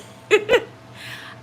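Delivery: high, breathy burst of laughter; laughing. Real, not acted. Streamer cracking up after her Cruella attempt, breathy laugh. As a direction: crack up at your own silliness. Laughs at own silly impression.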